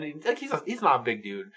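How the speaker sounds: ordinary talk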